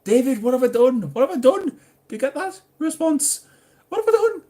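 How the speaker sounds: high, loud, hammed-up protest; mock indignant shout. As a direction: demand an answer in a squeaky yell. Mock alarm at viewer David's message.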